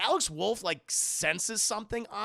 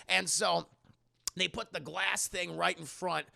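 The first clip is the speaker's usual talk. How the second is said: usual speaking voice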